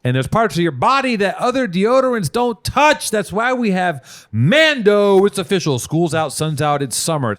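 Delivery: high, loud, over-the-top commercial voice; hyped ad pitchman. Acted. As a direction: sell it like a late-night TV pitchman. Hammed-up ad read in the middle of chat.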